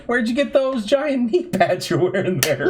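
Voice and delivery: high, amused question breaking into laughter; laughing. Retold or imitated. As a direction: ask it through a laugh. Teasing coworker over knee pads.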